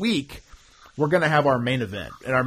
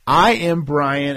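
announcer voice; 4 semitones higher, 8 dB louder, slower than usual. Acted. Announces show like ring announcer.

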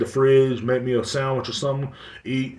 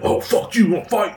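mock aggressive; 10 semitones higher, 4 dB louder than usual. Little guy picking a fight.

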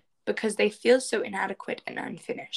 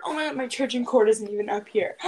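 ordinary delivery

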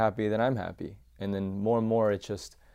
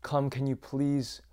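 higher-pitched pleading child's call, retold; pleading, raised-pitch retold cry for comfort; crying out for mom; 6 semitones higher than usual. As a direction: re-enact a child calling out for mom. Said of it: "just start crying out for my mom".

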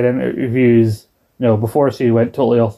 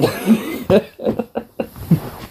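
unvoiced laugh; 8 semitones higher, 4 dB quieter than usual. Real, not acted. Laugh after quote.

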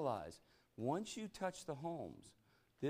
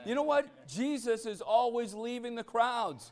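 preaching at full force; 10 semitones higher, 10 dB louder than usual. Real, not acted. Preacher driving the verse home to the church, at full force. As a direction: proclaim it to the whole room.

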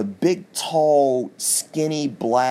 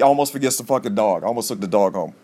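usual speaking voice

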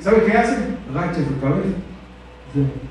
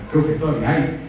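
ordinary delivery

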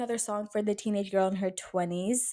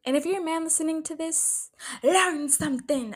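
teasing; 8 semitones higher than usual. Pretend. Teasing male listeners.

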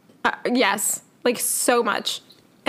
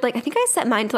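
ordinary talk

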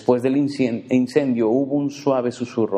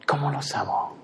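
tender repeat; 5 dB quieter than usual. Acted. God repeating his love for anxious people, softly. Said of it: "me imagino que suspira y dice".

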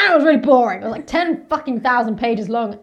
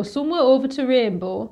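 quick Northern English buzz phrase, "over" lifted; tossing off the Northern English accent line; 2 semitones higher than usual. Shown as a demonstration. Drama school grad repeating Northern English buzz line. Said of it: "getting into a British Northern accent".

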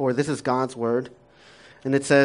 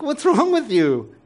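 high, loud, slow what's wrong; exasperated question; 10 semitones higher, 4 dB louder, slower than usual. Retold or imitated. Father mocking his son's worry, high loud disbelief. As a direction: throw it at him in disbelief.

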